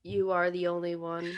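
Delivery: lines read with zero feeling; mock wooden delivery; deadpan, no emotion. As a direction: imitate a wooden actress. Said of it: "but with no emotion".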